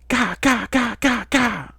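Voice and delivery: very high, loud staccato bursts; high-pitched rapid-fire yells; shouted gunfire mime. A narrator's reading. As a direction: shout mock weapon fire. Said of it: "she shouted".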